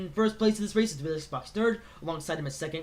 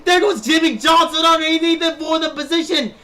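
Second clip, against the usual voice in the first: shouting, excited live play-by-play; race commentator; 6 semitones higher, 9 dB louder than usual. Acted. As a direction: call the race at full hype.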